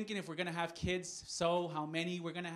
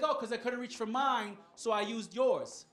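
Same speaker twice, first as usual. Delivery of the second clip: lightly voiced wife, pitched up; imitated partner's higher-pitched excuse; wife's retort; 5 semitones higher, 5 dB louder than usual. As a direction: answer back a bit louder, stretching the ending. Wife's reply in retold quarrel.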